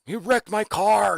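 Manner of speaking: high shrill yelling mock; mock upset yell; angry wife. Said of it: "Tiffany texts me, is like".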